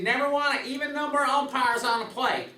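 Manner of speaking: imitating a Texas twang; Jim Evans Texas twang. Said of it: "in his Texas twang".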